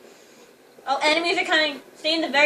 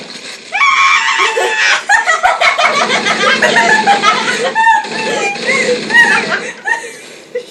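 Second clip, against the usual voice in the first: high, shrieking uncontrolled laughter; long loud laughing fit; 12 dB louder than usual. Real, not acted. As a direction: burst out laughing and keep going. Laughing at the game mishap.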